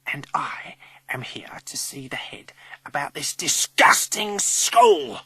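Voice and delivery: menacing whisper that erupts loudly; whisper growing to roar. A narrator's reading. Old lady demanding to see the head, whisper building to roar. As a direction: start whispered, explode on the last words. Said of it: "The whisper suddenly grows to a roar".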